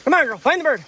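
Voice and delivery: high, loud urging call; calling the dog. Real, not acted. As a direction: urge the dog on from afar. Urging dog to find bird.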